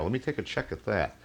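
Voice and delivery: ordinary talk, nothing marked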